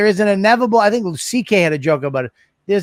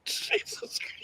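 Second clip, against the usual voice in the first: breathless shocked whisper; real shocked whisper; stunned whisper; 16 dB quieter, slower than usual. Real, not acted. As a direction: gasp it out in disbelief. Shocked by the story.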